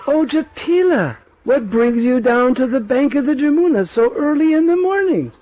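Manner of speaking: teasing greeting. Acted. Lalita greeting the snooping Kutila, teasing. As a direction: greet her with sly amusement.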